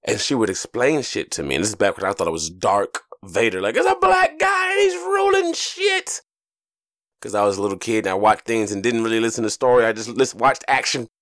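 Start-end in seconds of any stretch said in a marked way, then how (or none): 3.8-6.2 high-pitched, drawn-out mock excitement; excited kid reaction. His younger self marveling at Dark Vader, excited. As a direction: gush like an awestruck kid, very high.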